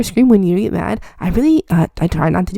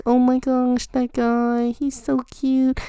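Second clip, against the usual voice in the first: a hint of a shy gushing guy, slow at the end; higher, starry-eyed little nerdy guy; mild swoon over a cute guy; lightly voiced smitten nerd; 7 semitones higher, much slower than usual. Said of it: "He's like".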